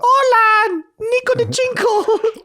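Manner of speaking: very high, drawn-out comic call; hammed-up greeting. Mock relative greeting by nickname.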